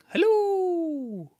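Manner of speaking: high, loud, long-held singsong hello; friendly calling out. A narrator's reading. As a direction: call brightly to someone far away. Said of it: "saw someone waving at them and going".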